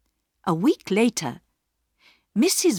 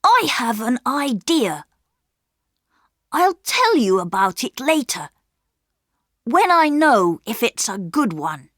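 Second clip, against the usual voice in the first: put-on higher, eager boy's voice; a keen young brother with a plan; boy Peter; 5 semitones higher, 4 dB louder than usual. A narrator's reading. Peter whispers a secret plan to his sisters, eager. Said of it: "Peter told his sisters".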